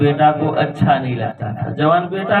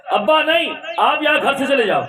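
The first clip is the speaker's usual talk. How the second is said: impatient son; 10 semitones higher than usual. Acted. Son tells father to leave.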